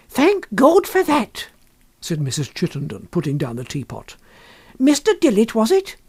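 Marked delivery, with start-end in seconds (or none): 0.1-1.5 high, slow relieved woman's voice; a lightly voiced shopkeeper's wife, relieved. A narrator's reading. 4.8-6.0 a lightly voiced shopkeeper's wife, relieved. A narrator's reading.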